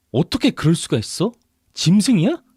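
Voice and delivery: high-pitched, shocked outrage; outraged. A narrator's reading. Wife to husband who admits the affair, outraged. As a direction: lash out at his confession.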